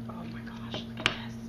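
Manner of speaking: breathless whisper of awe; awed whisper. Real, not acted. Unboxing a friend's gift, whispering in awe.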